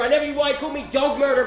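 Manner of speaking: usual speaking voice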